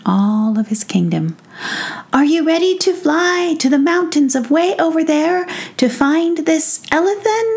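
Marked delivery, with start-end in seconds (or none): 2.1-7.5 much higher, bold call to fly; a leader rousing his troops; rallying prince voice. Acted. Prince rallies fairies.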